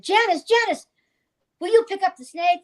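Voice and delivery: imitating the producer. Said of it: "Peter Locke said".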